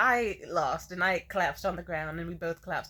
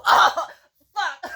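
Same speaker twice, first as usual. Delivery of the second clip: sudden yelp, much higher and louder; pained yelp; 10 semitones higher, 12 dB louder than usual. Retold or imitated. Girl karate-chopped in the throat by a clumsy kiss, yelping. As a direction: cry out as if hit in the throat.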